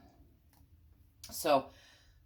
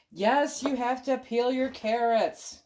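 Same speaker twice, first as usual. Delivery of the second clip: arguing with myself; 6 semitones higher, 5 dB louder, slower than usual. Pretend. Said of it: "Stop arguing with me".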